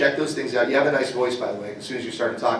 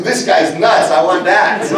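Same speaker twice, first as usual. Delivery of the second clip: Spielberg quote; 4 semitones higher, 8 dB louder, slower than usual. Retold or imitated. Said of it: "he goes".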